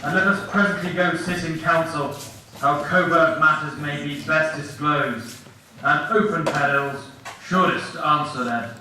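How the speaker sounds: slow, low stage declamation; declaimed Shakespeare. Acted. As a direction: proclaim it gravely to the whole theatre.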